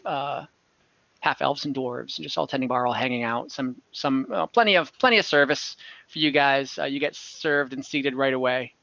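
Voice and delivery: normal talking voice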